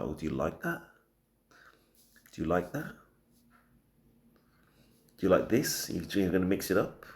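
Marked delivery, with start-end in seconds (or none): 0.0-5.9 low, soft, confident murmur; a lightly voiced low, sultry question; bass and confidence. Shown as a demonstration. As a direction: drop into the low seductive register. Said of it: "put some little bit of bass".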